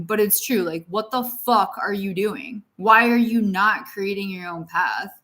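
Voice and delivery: ordinary delivery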